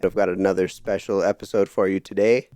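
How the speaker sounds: ordinary talk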